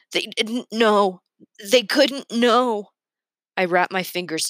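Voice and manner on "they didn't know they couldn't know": slow, choked plea, pitched up; forcing words out between chokes; choking out words. A narrator's reading. Boy to the lieutenant after being sick, choking. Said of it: "I choke and I tell him".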